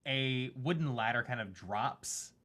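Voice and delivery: normal talking voice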